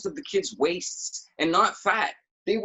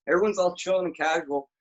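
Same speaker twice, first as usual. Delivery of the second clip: ordinary talk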